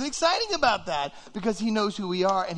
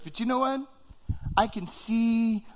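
ordinary talk, nothing marked

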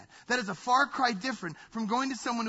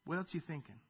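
soft, calm voice of God; God gently coaxing an angry child; gentle God; 4 semitones lower, 11 dB quieter, faster than usual. Retold or imitated. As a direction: answer patiently and kindly. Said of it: "And God says".

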